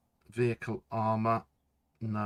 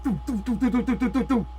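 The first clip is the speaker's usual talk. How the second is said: high mouth-made gunfire sounds; machine gun noises; 12 semitones higher, 6 dB louder than usual. Retold or imitated. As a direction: rattle off the turret's shots. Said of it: "it just was like".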